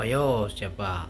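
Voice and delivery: ordinary talk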